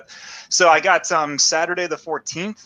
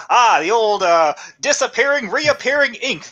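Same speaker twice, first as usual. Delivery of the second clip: hammed-up, higher-pitched cartoon-film voice; movie-ending reveal; 6 semitones higher than usual. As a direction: explain the twist like a corny movie finale. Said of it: "It's like the ending of Who Framed Roger Rabbit".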